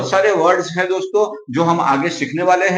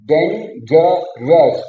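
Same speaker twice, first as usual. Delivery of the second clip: Dangerous stretched very slowly, three separate parts; slow syllable split; much slower than usual. Shown as a demonstration. Teacher breaking dangerous into three parts for students. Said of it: "वो तीन अलग अलग part में है".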